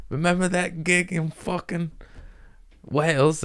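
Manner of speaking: high-pitched put-on London accent; high, loose stab at London accent; mock London accent. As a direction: fake a London comic reminiscing, voice way up high. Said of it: "I don't know what's fucking London-y".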